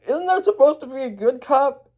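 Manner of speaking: high, naive questioning voice; puzzled Emmett. Acted. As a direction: ask it up high like an innocent. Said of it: "he's like".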